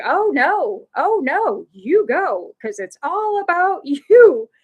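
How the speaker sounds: high, swooping mock politeness; exaggerated sarcasm, swelling loud and drawn out on the end; imitated sarcastic outrage. Hot mother with kids answers the apology, bitterly sarcastic. Acted sarcastic retort from angry lady.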